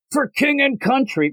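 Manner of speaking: high mock rallying call; battle cry. Knight charging into battle, high mock cry. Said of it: "the one guy goes".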